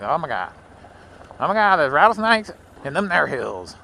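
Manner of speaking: imitating panicky locals, rising high and loud on the second line; hammed-up alarm about snakes in the hills; mock panic. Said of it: "Everybody's freaking out".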